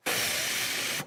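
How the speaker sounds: long, soft voiceless sigh; exasperated sigh. Real, not acted. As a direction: let out a long exhale of exasperation. Exasperated at how people write.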